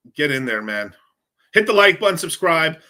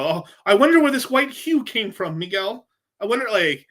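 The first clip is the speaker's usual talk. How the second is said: higher, louder mock musing, dripping with irony; sarcastic mock wonder; 7 semitones higher, 6 dB louder than usual. Streamer mocking people who deny Spanish ancestry, sarcastic and higher. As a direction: pretend to innocently wonder, teasing.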